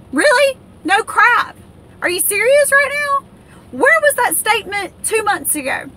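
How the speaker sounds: outraged disbelief. Real, not acted. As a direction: blurt it out, fed up and stunned. Outraged reaction to Fox News.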